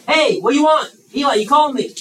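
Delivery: loud, high-pitched answer to a call; roused boy answering. Acted. Woken boy runs to the priest, calling out. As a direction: call out as if summoned from bed. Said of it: "goes to Eli".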